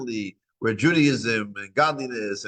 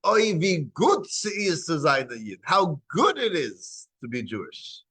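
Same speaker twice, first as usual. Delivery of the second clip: Oy, vi gut sie ist zu sein a Yid said brightly and higher; joyful version; 6 semitones higher than usual. Shown as a demonstration. Rabbi shows the joyful way to say the saying. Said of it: "you should say".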